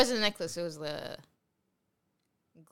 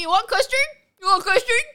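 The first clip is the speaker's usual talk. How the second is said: high, loud, nagging repeat; mocking his pestering; 9 semitones higher, 7 dB louder than usual. Girlfriend mocking boyfriend's repeated offers, high nagging. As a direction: parrot him like a pestering waiter. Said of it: "You told me".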